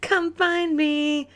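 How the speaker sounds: put-on high cutesy girl voice; imitating commercial's Courtney. Host replaying girl from dating ad, high cutesy voice. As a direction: mimic the ad girl, slowing into singsong. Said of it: "She's like".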